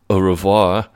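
put-on French accent on the farewell. [name] as Polly walks out, French accent. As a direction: bid goodbye in a French accent. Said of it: "you're, you're French".

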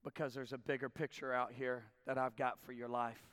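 low, soft, measured reassurance; gentle voice of God. Retold or imitated. God telling believer to look up, gently. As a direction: speak quietly and low, as God to a listener. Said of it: "God's saying".

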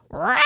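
harsh mock infant cry; imitated baby shriek. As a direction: go zero to eighty into a mimicked wail. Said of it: "He's like zero to eighty".

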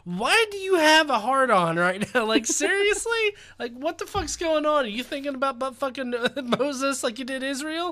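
high-pitched mock disbelief; incredulous angels. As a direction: question it in a high, appalled voice, amused. Acts angels questioning God.

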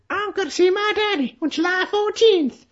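very high, put-on letter-reading voice; John Malkovich. John Malkovich reading out the letter on the plane, high mocking imitation. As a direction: send the pitch way up, mimicking a film villain. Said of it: "reading out the letter".

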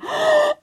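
sharp high gasp of shock. Retold or imitated. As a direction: gasp as if caught out. Shocked by another mum's remark.

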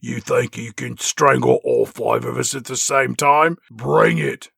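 put-on low taunting cockney girl; mock cockney. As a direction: dare him in cockney. Spice Girls mocking villain.

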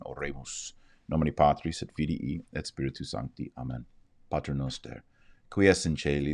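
soft, steady prayer recitation; Latin prayer. Real, not acted. Host leading viewers in the Our Father, soft prayer. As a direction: pray it reverently, in Latin.

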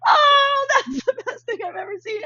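high, loud thrilled gush; delighted squeal. Retold or imitated. Commenters' delighted reaction quoted.

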